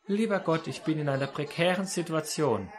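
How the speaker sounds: soft, unemotional mock plea to God; mock restrained prayer. Preacher mimics a quiet person praying from a cliff edge, restrained. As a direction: pray politely, holding all emotion back. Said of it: "ich bin ein sehr stiller Mensch".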